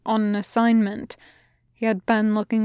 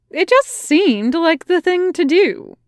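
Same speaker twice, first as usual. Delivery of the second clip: higher soldier voice, a touch puzzled; soldier's voice; 8 semitones higher, 4 dB louder than usual. A narrator's reading. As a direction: pitch up, mildly puzzled.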